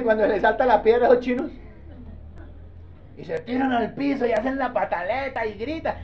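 normal talking voice

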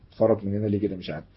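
ordinary delivery

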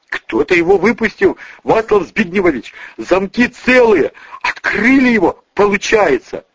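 high, agitated, stumbling report; panicked explaining. Acted. Bodyguard explains who escaped, high and panicky. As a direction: stumble onto the nickname.

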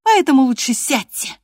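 high, loud order; defiant declaration. A narrator's reading. Girl orders the master to sit, defiantly. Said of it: "заявила она".

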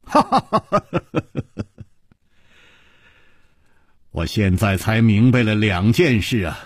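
大笑着说; laughing. A narrator's reading. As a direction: laugh first, then explain. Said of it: "楚留香忽然微笑着说".

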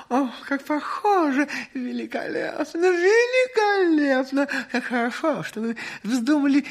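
high, gushing cries of delight; joyful cry. Acted. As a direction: squeal with rapturous admiration. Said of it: "издали радостный крик изумления".

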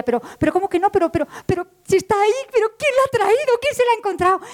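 high, urgent pleading; excited insistence. Acted. Woman insisting to the smiling clerk, high and urgent. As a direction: press him, half laughing.

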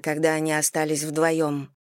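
usual speaking voice

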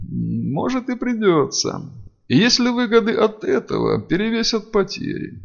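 cool, cutting sarcasm; venomous reply. A narrator's reading. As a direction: answer with understated venom. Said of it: "ядовито ответил Хедин".

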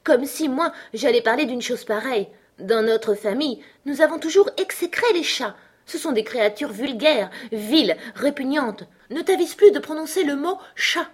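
high, scornful tirade; indignant mouse. Acted. The mouse denounces cats to Alice, high indignant scolding.

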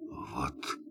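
hushed, startled whisper; a soft, stunned whisper; surprised whisper. Acted. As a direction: barely breathe the question in surprise. Surprised captain hears he'll be spared.

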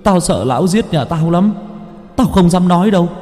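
put-on a boy sobbing his apology over a grave; tearful apology. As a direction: beg the dead girl's forgiveness.

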